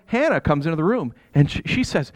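usual speaking voice

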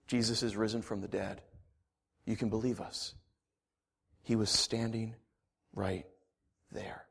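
low, soft reassuring voice; calming. Acted. John calming Thomas down, soft and low. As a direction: soothe them gently.

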